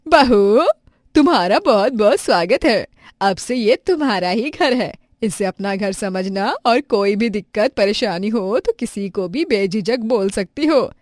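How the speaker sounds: loud, warm welcome; mother-in-law welcoming the new bride. Acted. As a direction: greet the bride with open arms.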